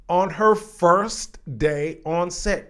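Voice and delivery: ordinary talk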